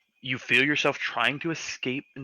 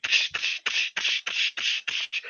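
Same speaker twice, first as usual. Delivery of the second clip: imitated hissing footsteps. Game master making the creature's approaching sound, hissing. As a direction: make the creeping steps of a monster.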